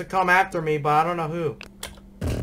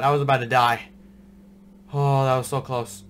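ordinary talk, nothing marked

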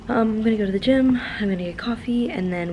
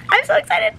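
excited squeal; 4 dB louder than usual. Real, not acted.

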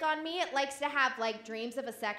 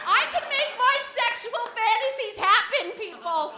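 triumphant shout; 9 semitones higher, 8 dB louder than usual. Real, not acted. Comic boast to the audience.

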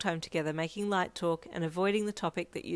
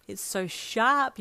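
amazed; 5 semitones higher, 6 dB louder, much slower than usual. Acted. Marvelling at his tooth.

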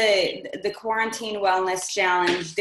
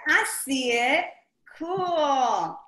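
ordinary talk

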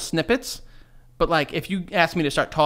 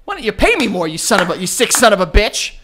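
raised, louder joke outburst; hammed-up angry demand; mock outrage; 3 semitones higher, 5 dB louder than usual. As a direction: blow up at the boss as a bit. Mock outburst at boss over pay.

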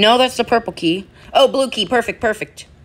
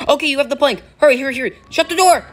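high rushed urging; urgent; 7 semitones higher, faster than usual. Real, not acted. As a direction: hurry them along. Urging teammate mid-chase.

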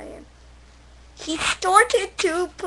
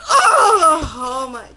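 ordinary delivery

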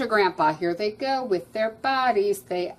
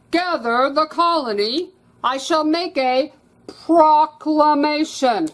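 higher, louder royal proclamation; heroic regal voice; 7 semitones higher, 8 dB louder than usual. Acted. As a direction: proclaim like a noble king. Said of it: "in his heroic, regal voice".

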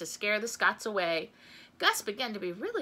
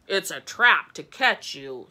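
slow, loud, lower voice; heavy, deliberate warning; Gus, warning; 4 semitones lower, 8 dB louder than usual. A narrator's reading. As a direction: warn firmly, word by word. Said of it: "said Gus".

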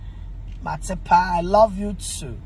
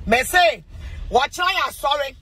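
excited shout to the live viewers; 6 semitones higher than usual. Real, not acted. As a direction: call it out over the room. Calling out to viewers.

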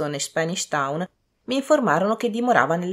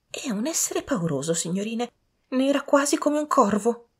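servant; 8 semitones higher than usual. A narrator's reading. Servant describing the creature to young ladies, high servant voice. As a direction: describe her in a hushed start, climbing higher.

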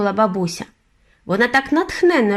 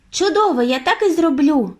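put-on eager boy; 8 semitones higher than usual. A narrator's reading.

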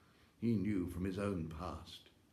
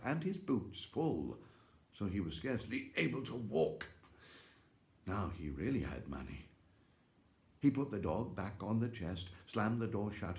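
ordinary delivery, nothing marked

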